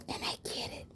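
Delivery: hushed, breathy whisper of a secret. Acted. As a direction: whisper it like you're letting them in on something. Sharing a secret of success.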